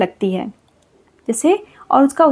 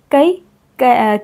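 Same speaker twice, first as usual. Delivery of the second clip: slowed demo; much slower than usual. Shown as a demonstration. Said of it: "slow demo of ai vowel".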